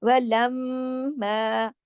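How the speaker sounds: वलम्मा with the मम hummed long; very slow nasal recitation of वलम्मा; full nasal ghunna. Shown as a demonstration. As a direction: hum the double म of वलम्मा. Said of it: "पूरे गुन्ने के साथ".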